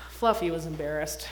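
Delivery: usual speaking voice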